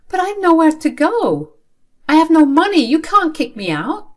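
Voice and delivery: very high, loud, distressed protest; desperate pleading. Acted. Child being kicked out by father, desperate plea. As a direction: plead in alarm, pitched right up.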